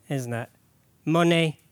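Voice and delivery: normal talking voice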